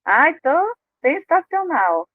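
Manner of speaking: "tô sensacional" drawn out slowly and pitched high; fake cheer. Shown as a demonstration. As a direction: stretch "sensacional" slowly in a falsely upbeat way. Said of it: "Eu uso essa palavra quando eu tô meio assim".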